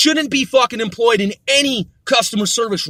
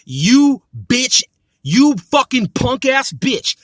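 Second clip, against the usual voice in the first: usual speaking voice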